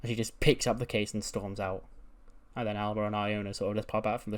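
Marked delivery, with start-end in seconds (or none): none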